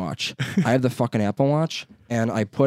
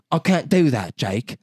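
lightly mocked Australian Siri, starting higher; goofball Australian accent; 4 semitones higher, slower than usual. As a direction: imitate a refusing Australian voice assistant. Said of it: "goofball Australia accent".

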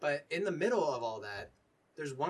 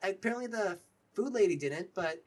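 high, eager character voice; higher-pitched awkward small talk; friendly, awkward Dylan; 7 semitones higher, 3 dB louder than usual. Acted. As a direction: pay a shy compliment, then ask. Game master plays Dylan complimenting the flute.

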